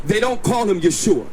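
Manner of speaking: usual speaking voice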